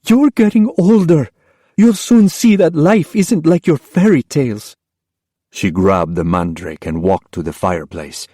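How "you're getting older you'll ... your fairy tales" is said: high, sharp, impatient voice; mother impatient with daughter. Acted. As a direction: cut her off sharply. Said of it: "It was sharp with impatience".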